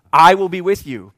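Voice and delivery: loud, firm divine reply; insistent God. Acted. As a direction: override the protest at full volume. God answering Moses' objections.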